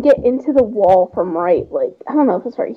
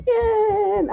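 high, soft, drawn-out spooky bacon; mock the eerie call, hushed and high; lightly voiced creepy bacon wail; imitating creepy bacon voice; 9 semitones higher, 5 dB quieter than usual. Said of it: "I'm gonna have nightmares about that".

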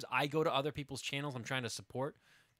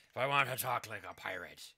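normal talking voice

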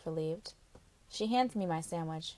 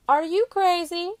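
much higher, louder outburst; incredulous refusal; 11 semitones higher, 9 dB louder than usual. Acted. Carla refusing the visit, loud and much higher. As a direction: cut her off in disbelief.

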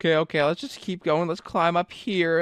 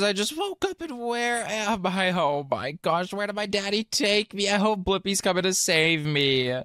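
ordinary delivery

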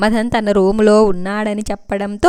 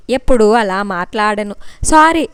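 usual speaking voice

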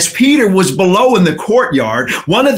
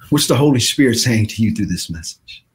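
ordinary talk

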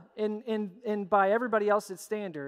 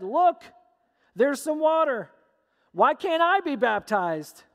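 higher, louder burst of eager discovery; excited plea; 5 semitones higher, 6 dB louder, slower than usual. Acted. Eunuch spots water and asks Philip for baptism, excited. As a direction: call it out eagerly, pitched up.

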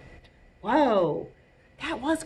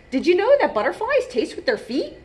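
high, excited fun-fact voice; eager butterfly; 9 semitones higher, 5 dB louder, faster than usual. A narrator's reading. Toast telling the new snail a fact, eagerly. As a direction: share trivia like a keen little creature.

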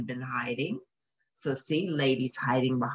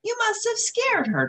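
Lady cooing; 3 dB louder than usual. Acted. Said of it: "Lady cooed".

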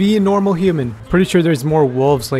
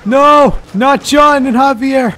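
loud, much higher, drawn-out wail of dismay; dismayed shout; 10 semitones higher, 7 dB louder, slower than usual. Real, not acted. Gamer seeing friends killed, loud dismayed wail. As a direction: cry out as the friends die. Companions die suddenly.

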